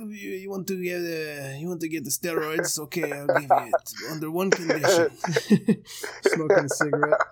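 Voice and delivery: lightly put-on French accent, spy doctor offering drugs; sly French villain voice, only a hint of the accent. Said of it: "Gotta do that in a French accent".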